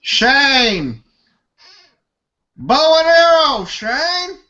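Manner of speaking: high-pitched hammed-up Southern holler; Southern yell. Host takes his turn at Walking Dead impression, Southern yell. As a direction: call out the name, hammed-up Southern. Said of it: "yell out a name in a southern accent".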